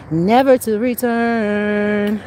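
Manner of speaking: drawn out very slowly, every word stretched; slow solemn proclamation. Real, not acted. To the demons, sealing their fate: slow drawn-out proclamation. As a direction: intone it like a final decree, slowly.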